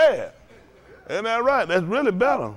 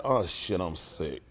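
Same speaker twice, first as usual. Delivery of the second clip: much lower and much softer, deflated; dismayed; 13 semitones lower, 8 dB quieter, much slower than usual. Retold or imitated. Preacher seeing his wrecked truck, much lower and deflated. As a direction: sink at the sight of the damage.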